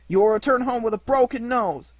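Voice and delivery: high, loud menace; threat. A narrator's reading. Boy warning Pinocchio of blows, loud and high. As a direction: promise him a beating.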